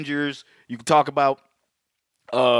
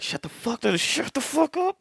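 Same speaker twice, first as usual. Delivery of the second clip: friends hushing him; 11 semitones higher, 3 dB quieter than usual. Retold or imitated. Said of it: "They're like".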